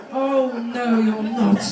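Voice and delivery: a pantomime "oh no you're not" from mum; mock mum telling off. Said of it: "And she said".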